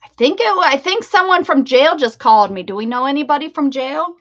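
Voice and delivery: high, loud and incredulous; shocked disbelief. Real, not acted. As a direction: blurt it out, stunned and amused. Shocked a jail number called.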